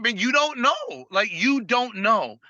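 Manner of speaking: high, insistent retold challenge; emphatic insistence. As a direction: insist they have no idea, pitched high. Retelling his insistence to prospects.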